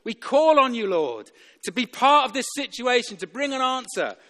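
loud, high, insistent plea; desperate prayer. Acted. Preacher modelling calling on the Lord, desperate prayer. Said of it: "we need to be desperate like Bartimaeus".

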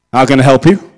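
a hint of a busy, weary clerk; curt clerk. New clerk greets the vicar.

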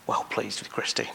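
a hushed whisper naming her; near-silent whispered blessing. Acted. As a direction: breathe the blessing softly. The Lord blessing each by name.

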